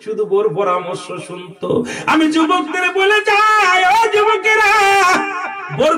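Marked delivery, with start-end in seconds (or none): 2.1-5.9 full-force exhortation. Acted. Preacher warning the young men, high full-force exhortation. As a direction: exhort the crowd high and ringing.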